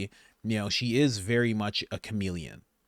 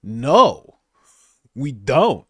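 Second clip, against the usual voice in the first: loud flat no; 8 dB louder, much slower than usual. Pretend. Answering pretend foreigner.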